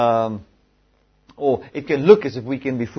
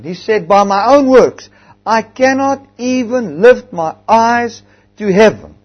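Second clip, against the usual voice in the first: full-force preaching; 6 semitones higher, 10 dB louder than usual. Acted. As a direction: build the climax and hammer it home. Preaching climax, loud.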